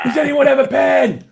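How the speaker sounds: high, loud call-out; mock yelling. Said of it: "He starts yelling".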